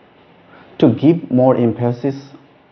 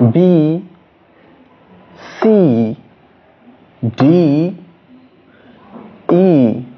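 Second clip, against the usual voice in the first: B, C, D, E each with a long, higher, emphatic "ee"; emphasis on vowel; 4 semitones higher, much slower than usual. Shown as a demonstration. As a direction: lean hard on the E vowel in B, C, D, E. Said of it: "you need to give more emphasis".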